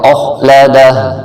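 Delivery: اخلدہ slowly, heavy خ, ending on a short دہ; Quranic recitation. Shown as a demonstration. Teacher reciting اخلدہ for students, slow. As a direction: recite اخلدہ slowly, cutting the final vowel to دہ. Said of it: "دہ بن جائے گا".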